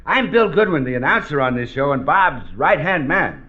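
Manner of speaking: ordinary talk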